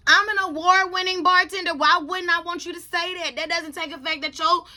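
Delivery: worked-up, emphatic bragging complaint; indignant self-defence. Real, not acted. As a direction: insist on your due, loud at first. Defending own award-winning title.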